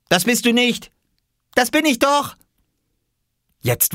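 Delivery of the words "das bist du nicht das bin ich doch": put-on very high kid voices bickering; high, loud childish contradiction; children squabbling. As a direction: bicker back and forth like children. Children's yes-no quarrel.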